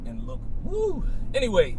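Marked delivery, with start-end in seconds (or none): 0.6-1.0 high whoop to shake it off; excited whoop. Real, not acted. Host whooping to move past the pain. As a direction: snap back with a whoop.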